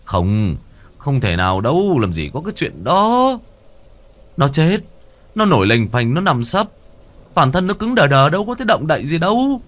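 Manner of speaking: insisting, high and loud; firm and insistent. Acted. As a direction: flatly deny it, adamant. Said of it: "Ông Năm quả quyết".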